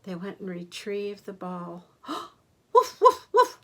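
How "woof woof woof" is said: high, loud mock woofs; dog barking. Grandma barking as the dog in the picture book. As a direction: bark like a little dog for the kids.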